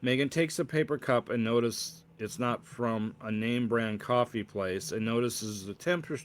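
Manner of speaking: ordinary delivery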